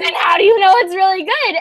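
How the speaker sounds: high, loud cross-examining; pointed, incredulous question; accusing challenge. Retold or imitated. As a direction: re-enact catching him out. Retold interrogation of boyfriend.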